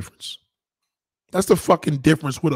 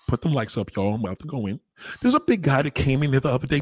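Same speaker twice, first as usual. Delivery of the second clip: ordinary talk, nothing marked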